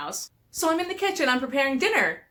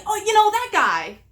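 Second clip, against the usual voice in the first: high breezy mock of the husband, waving it off; imitating husband's vague answer; 10 semitones higher, 4 dB louder than usual. Wife mimics husband shrugging off a name, high and airy. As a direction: play him as if it's obvious.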